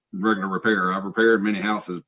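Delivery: normal talking voice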